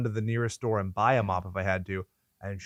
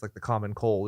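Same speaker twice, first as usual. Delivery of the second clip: normal talking voice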